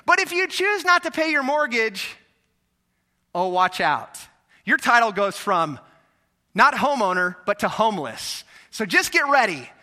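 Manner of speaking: a hammed-up bank giving a warning; mock bank voice. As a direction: play the bank threatening the homeowner. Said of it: "They said".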